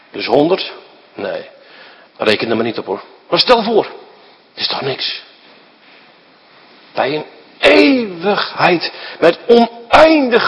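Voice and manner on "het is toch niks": slow, soft, breathy shrug; whispered, slow: it is nothing; whispered dismissal. Acted. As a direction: let it fall to a whisper, it is nothing. Dismissing worldly pleasure.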